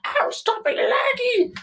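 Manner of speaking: very high-pitched mock angry squeal. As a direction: squeal it in exaggerated gamer frustration. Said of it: "I was, like, angry".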